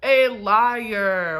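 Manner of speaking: loud, drawn-out accusation; indignant accusation. Acted. Patient rebutting the nurse's promise, indignant. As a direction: call it out slowly and emphatically.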